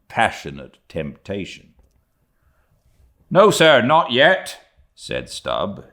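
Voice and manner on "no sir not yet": loud, high, defiant refusal; emboldened. A narrator's reading. Stubb refusing his captain's order, emboldened and loud. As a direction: stand up to him at full voice.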